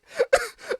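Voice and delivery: short high-pitched disbelieving laugh. Real, not acted. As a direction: laugh it off in shock. Reacting to villain's threat.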